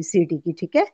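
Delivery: usual speaking voice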